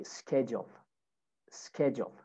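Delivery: American "schedule" starting with SK; American accent. Shown as a demonstration. Teacher models American "schedule" for students, SK at the start. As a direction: say "schedule" as American "sked-jool". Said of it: "In America".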